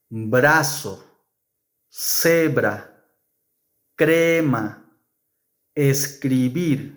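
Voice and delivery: brazo, crema, tren with a single tapped r; soft r. Shown as a demonstration. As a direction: tap the r once after the consonant. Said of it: "Whenever the letter R is after these consonants".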